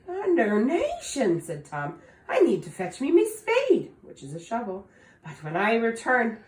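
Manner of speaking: fretful Tom. Acted. Tom worried about finding bush.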